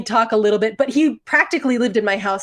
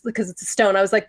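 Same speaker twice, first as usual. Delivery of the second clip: ordinary talk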